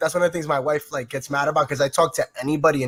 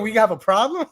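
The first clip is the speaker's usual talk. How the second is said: quick challenging "We have a problem?" with a rising end; confrontational challenge; 4 semitones higher than usual. Shown as a demonstration. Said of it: "That's how we say it".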